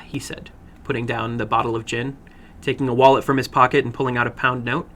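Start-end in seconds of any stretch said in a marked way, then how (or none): none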